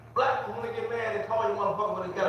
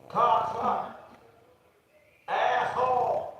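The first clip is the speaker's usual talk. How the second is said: slow mock insult; 2 semitones higher, much slower than usual.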